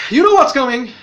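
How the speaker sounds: dramatic hint of doom; ominous tease. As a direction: build suspense for the twist. Teasing the twist to come.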